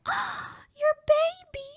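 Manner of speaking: put-on very high, slow baby-talk coo; cooing staff. Staff cooing to owner about her cat, high baby talk. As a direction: coo like you're talking to a baby.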